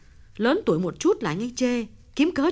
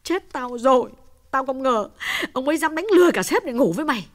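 much higher, fast panicked realisation; 14 semitones higher, faster than usual. A narrator's reading. Uyên realises on the phone her husband tricked Hồng, panicked. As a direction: cut in, horrified. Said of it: "Kim Uyên hốt hoảng ngắt lời".

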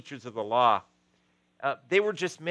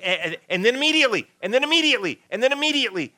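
fast, much higher, breathless rush of words; hyper Peter; 10 semitones higher, 8 dB louder, much faster than usual. Retold or imitated. Peter telling the story, imitated in a fast, excited rush. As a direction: rattle off the "immediately"s like a kid who can't stop. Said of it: "And he was going and going".

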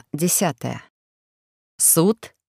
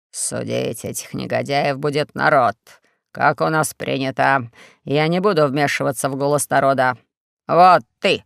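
Four-Eyes; 5 semitones lower than usual. Acted. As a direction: drone the accusations like a sly dictator. Four-Eyes addresses crowd.

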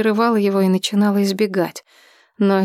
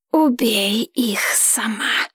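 whispering dragon; much slower than usual. Acted.

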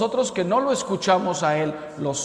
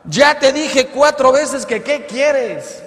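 high, loud, slow yell; exasperated shout; 9 semitones higher, 8 dB louder, slower than usual. Acted. Wife answering her husband for the fourth time, exasperated shout. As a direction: yell back at full volume, drawing it out.

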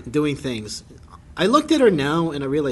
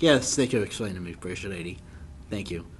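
mock polite scientist; 8 semitones lower, 8 dB quieter than usual. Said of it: "He's like".

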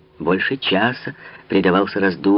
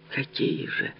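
sorrowful question; 5 semitones higher, 10 dB quieter, slower than usual. A narrator's reading. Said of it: "спросила горестно Анна Австрийская".

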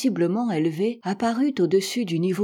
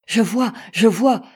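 louder, eager cry; excited exclaim; 2 semitones higher, 6 dB louder than usual. A narrator's reading. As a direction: call out that you see it. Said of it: "s'écria le docteur". (s'écrier avec excitation, plus fort)

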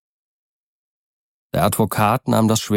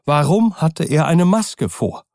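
put-on frightened old servant; 8 semitones higher than usual. A narrator's reading. Poole, frightened.

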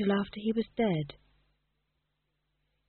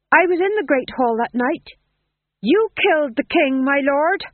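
earnest fool; 8 semitones higher, 7 dB louder than usual. Acted. Fool accuses the Duke.

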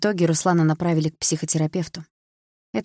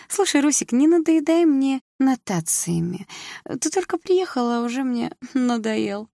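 much higher, mocking, slowed teasing; mocking laugh-talk; 8 semitones higher than usual. A narrator's reading. Drunk sister mocking her brother's lecture, high and teasing. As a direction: tease him as if lecturing were a joke. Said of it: "рассмеялась Саша".

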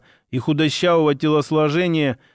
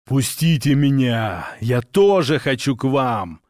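lower, slow demonic bellow; satanic voice; 3 semitones lower than usual. A narrator's reading. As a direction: roar it like something satanic. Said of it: "орал Ваня сатанинским голосом".

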